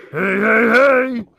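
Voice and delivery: high, loud mock "hey, hey, hey"; imitating Bill Cosby. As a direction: mimic a cheerful old comic greeting reporters. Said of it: "he was like".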